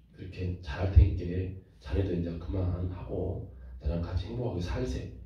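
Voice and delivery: low, soft, tender Jeolla dialect plea. Retold or imitated. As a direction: coax a wife home gently in Jeolla dialect. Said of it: "전라도 말로 한번 해볼게요". (낮고 다정한 전라도 사투리)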